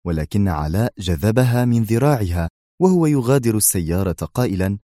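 usual speaking voice

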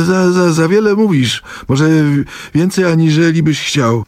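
high, pointed warning; with emphasis. Acted. Ignacy warns Wokulski pointedly, in a high, insistent voice. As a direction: lean on every word. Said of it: "dodał z naciskiem".

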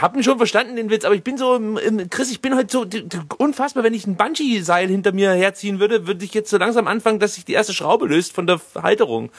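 hyper and wound up, rising pitch; buzzing with overexcited energy; too much caffeine. Real, not acted. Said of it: "ich bin so aufgedreht heute ... voller Energie".

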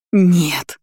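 a desperate cry of no. A narrator's reading. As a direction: cry out in despair. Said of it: "отчаянный крик". (отчаянный крик)

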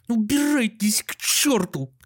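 high, slow, acted wailing outburst; tearful cry. A narrator's reading. Doctor chases guests into the hall, tearful yell. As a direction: cry it out on the verge of tears. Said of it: "крикнул он плачущим голосом".

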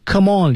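"Come on" run together as one word; high-pitched, joined-up "Come on"; linked reading. Shown as a demonstration. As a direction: link the m straight into "on". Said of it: "连读".

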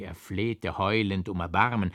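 ordinary delivery

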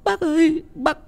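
very high, halting panic; frightened stammering. A narrator's reading. Mother pointing at the tree to the family, frightened stammering. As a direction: stammer out the awful news in a high voice. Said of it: "Mẹ chỉ vào cái cây lắp bắp".

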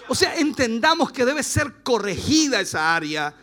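ordinary delivery

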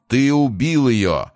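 loud, high, drawn-out angry cry; wrathful. A narrator's reading. Deformed creature answers Price, accusing him with wrath. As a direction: hurl the accusation slowly in loud anger. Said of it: "с гневом ответило жуткое".

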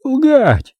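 very high-pitched disbelief; incredulous exclamation. A narrator's reading. Producer astonished at the idea of lying, incredulous. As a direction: cry it out in high, incredulous shock. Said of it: "Его глаза недоверчиво расширились".

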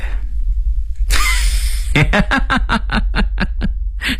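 high staccato villain cackle; long scornful laugh; villain's laugh. Acted. Gang boss laughs at warning. (反派高声狂笑)

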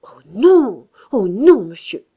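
loud, tearful cry, fading on monsieur; stammering protest. A narrator's reading. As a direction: stammer it out in panic. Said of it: "Elle finit par bégayer". (protestation bégayée, au bord des larmes)

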